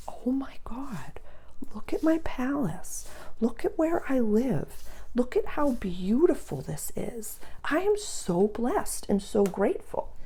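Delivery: breathless, hushed wonder at her own home; awed whisper. Retold or imitated. To herself after cleaning her house, an awed whisper. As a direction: whisper it like you can't believe your eyes.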